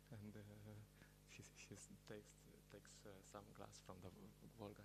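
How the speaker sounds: normal talking voice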